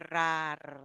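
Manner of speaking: "rar" opening on a long rolled R; difficult R transition. Shown as a demonstration. Teacher isolating the difficult syllable, with a long trill. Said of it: "This is more difficult".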